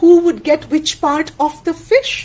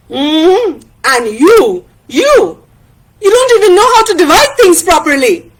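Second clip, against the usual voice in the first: loud, building to a quick higher jab; acted quarrelling friend; Tana, scornful retort; 5 semitones higher, 5 dB louder than usual. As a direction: fling the blame back.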